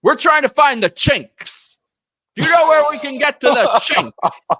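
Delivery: loud, high mock old grandpa; hammed-up loud grandpa asking directions; grandfather voice. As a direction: boom it out like a clueless old man. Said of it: "and he goes".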